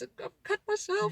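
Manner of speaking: a hint of a crying kid; imitating a child. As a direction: mimic a hurt kid complaining.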